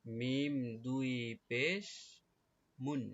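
slow letter-by-letter spelling of মুন; spelling-out recitation. Shown as a demonstration. Teacher showing pupils two pesh, slow spelling recitation. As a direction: slowly stretch মিম দুই পেশ, then a separate মুন. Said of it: "উচ্চারণ কি হবে".

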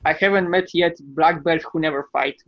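ordinary delivery

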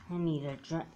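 normal talking voice

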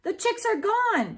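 loud, high alarmed shout. A narrator's reading. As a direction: shout the bad news in panic. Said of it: "shouted Daniel".

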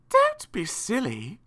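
high, slow spider voice; high creature nanny; spider nanny chiding. A narrator's reading. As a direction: chide them in a high, drawn-out voice. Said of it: "said the spider".

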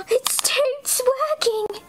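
wondering high voice; high slow amazed. Acted. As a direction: marvel at it slowly. Excited gasp realization.

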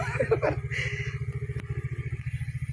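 high breathless giggle; a wheezing laugh burst; airy laugh. Real, not acted. Woman laughs finding father gone, airy giggle.

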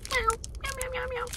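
high, soft kitten meows; cat meows. Pretend. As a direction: meow like a kitten. Playful cat noises.

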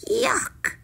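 very high cry of revulsion; high-pitched "eww" tone; disgusted princess. A narrator's reading. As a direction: squeal with disgust.

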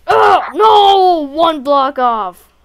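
loud frustrated yell; frustrated shout. Real, not acted. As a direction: shout out a missed jump. Missed jump in game.

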